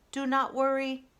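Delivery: calm the freezing animals; reassuring robot. Acted. Roz calming the cold crowd, steady reassuring robot voice.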